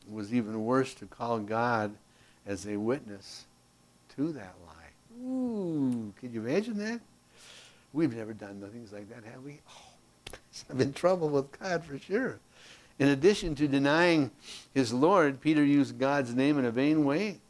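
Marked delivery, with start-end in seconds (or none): 5.2-12.4 mock guilt. Teacher teasing the class about lying.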